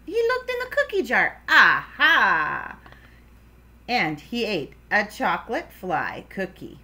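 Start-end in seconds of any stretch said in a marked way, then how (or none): 1.5-2.8 loud, drawn-out aha; triumphant discovery. Acted. Froggy finds what he wants in the cookie jar, triumphant. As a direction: exclaim it like a happy find.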